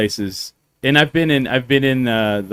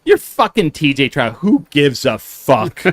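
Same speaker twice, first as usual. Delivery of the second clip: indignant retort; 4 semitones higher, 4 dB louder than usual. Retold or imitated. Acted retort in retold story.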